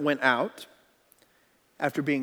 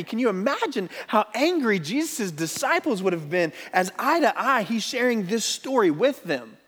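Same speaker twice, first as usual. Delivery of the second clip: ordinary talk, nothing marked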